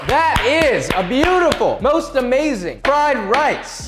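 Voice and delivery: high, slow, loud gushing praise; excited praise. Real, not acted. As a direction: gush over it slowly. Awed first taste of rice.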